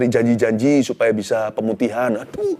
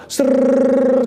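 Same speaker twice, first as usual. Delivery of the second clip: rolling reel noise; 11 semitones higher, 4 dB louder than usual. Retold or imitated. Imitating film reel rolling.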